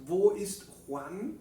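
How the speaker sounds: Wo ist Juan dicho despacio y claro; modelo en alemán. Shown as a demonstration. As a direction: pronuncia Wo ist Juan para que repitan. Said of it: "si preguntamos". (pregunta modelo en alemán, lenta)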